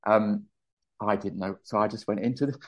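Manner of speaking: ordinary delivery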